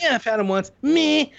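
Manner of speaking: high, snooty mimic of critics; mocking hater voice. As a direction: sneer it like a snob. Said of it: "Everyone's always gotta be like".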